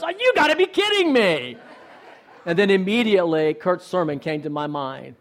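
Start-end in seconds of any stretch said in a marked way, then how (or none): 0.0-1.6 high-pitched retold outburst; exasperated disbelief. Driver reacting to the truck turning in ahead, exasperated disbelief. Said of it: "my first reaction, which was not always my best reaction".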